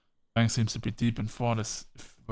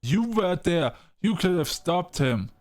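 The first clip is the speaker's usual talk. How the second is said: higher, louder accusing line; angry accusation; 6 semitones higher, 3 dB louder than usual. Acted. Daphne accusing Frank of not stopping him, angry. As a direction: throw the blame right at him.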